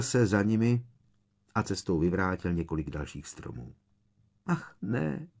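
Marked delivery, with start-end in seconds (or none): 4.5-5.3 high, soft, dismayed gasp; breathed in dismay. A narrator's reading. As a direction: breathe it out, horrified. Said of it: "vydechla Hermiona".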